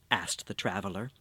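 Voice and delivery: plain reading voice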